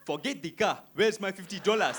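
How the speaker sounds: put-on loud, indignant African-accented demand; comic African accent, emphatic and punchy. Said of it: "I saw two African dudes".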